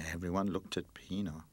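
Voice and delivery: ordinary delivery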